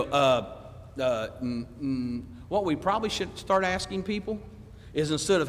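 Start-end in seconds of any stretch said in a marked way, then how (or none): 0.0-2.2 mock reluctant hemming and hawing; reluctant hesitation. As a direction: stall with low, trailing ums. Acting out a reluctant stammer.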